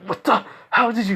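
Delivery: high-pitched, broken-off disbelief; shocked. Retold or imitated. Attacker whose arm was grabbed, shocked. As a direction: blurt half questions, pitch leaping up.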